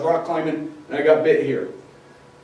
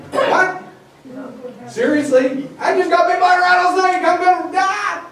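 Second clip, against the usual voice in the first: panicked victim; 10 semitones higher than usual. Acted. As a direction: play the bitten hiker freaking out. Acting out panicked snakebite victim.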